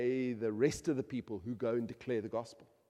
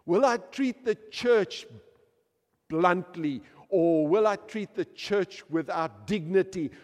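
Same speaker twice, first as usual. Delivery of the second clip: loud, high fervent preaching; full-force preaching; 7 semitones higher, 10 dB louder than usual. Acted. Preacher rousing the congregation about the church, fervent. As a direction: drive it home to the congregation.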